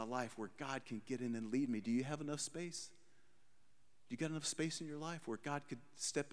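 quiet preaching. Acted. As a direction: pull them in, nearly confiding. Preacher drops to intimate appeal.

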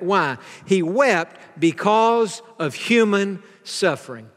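normal talking voice

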